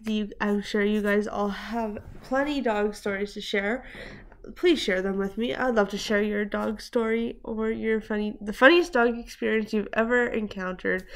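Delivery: normal talking voice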